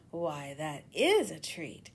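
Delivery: slow, drawn-out reptile voice; crocodile voice. Acted. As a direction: savour each word like a lazy crocodile. Crocodile delighted by offer.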